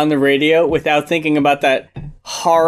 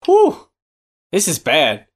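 theatrical disbelief; 8 semitones higher, much slower than usual. Acted. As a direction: pause between phrases, climbing to outrage. Acted listener's mounting disbelief.

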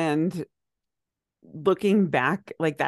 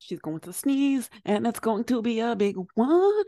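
announcer voice; 5 semitones higher than usual. Pretend. Mock announcing friend's sneeze.

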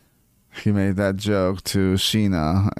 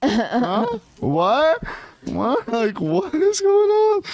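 much higher, confused, mid-chew mock surprise; mock bewildered Katie; much slower than usual. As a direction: play Katie stunned with her mouth full. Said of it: "and Katie, like, mid-chew".

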